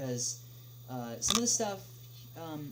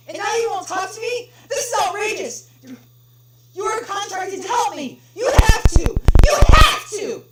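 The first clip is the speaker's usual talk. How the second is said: much higher, much louder outraged shouting; furious screaming; 17 dB louder than usual. Acted. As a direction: scream in outrage at the useless clerk. Caller screams at clerk.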